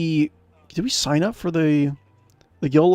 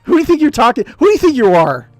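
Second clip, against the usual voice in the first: hammed-up indignation, loud and high; mock outrage; 10 semitones higher, 8 dB louder than usual. Streamer's character snapping back at the insulting guild leader, in loud mock outrage. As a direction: play it as a huffy, offended hero.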